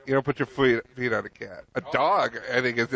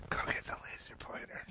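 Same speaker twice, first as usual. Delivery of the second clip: whisper; 17 dB quieter than usual. Pretend. Cat whispers scheme.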